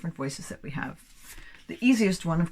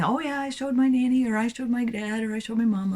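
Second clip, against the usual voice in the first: put-on higher-pitched, lightly childlike listing; imitated pupils' replies; 6 semitones higher than usual. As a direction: reel off answers like a keen pupil. Students answering the teacher.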